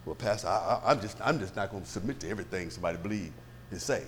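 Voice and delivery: low, soft, halting objection; hesitant mock objector, dropped low and quiet; reluctant churchgoer. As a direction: mimic a member backing away from submission. Mimics a reluctant church member.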